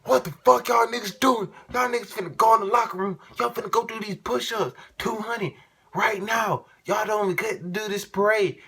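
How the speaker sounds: hammed-up raging coach voice, shrill and loud; furious coach yelling. Coach yelling at the team after the slap, a mock furious rant.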